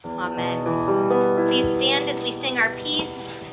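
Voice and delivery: ordinary delivery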